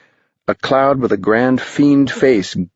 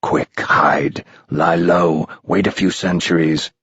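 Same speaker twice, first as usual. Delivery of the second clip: put-on low, sly old spirit; a spooky guide teasing boys; low, strange older voice; eerie old man; 4 semitones lower than usual. A narrator's reading.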